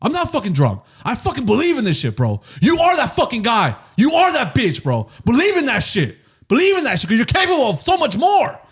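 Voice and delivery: high-pitched hammed-up drunken pep talk; mock-passionate, high drunken hype speech; impassioned drunken insistence. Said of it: "you say it with such passion".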